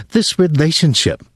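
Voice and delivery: high mocking echo of This relationship; taunting. A narrator's reading. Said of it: "she taunted him".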